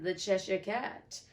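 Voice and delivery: plain reading voice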